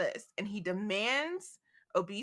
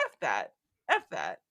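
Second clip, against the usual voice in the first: much lower, deadpan growl of refusal; defiant; 12 semitones lower than usual. Retold or imitated. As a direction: drop the voice down low and refuse. Defiant Bree voiced.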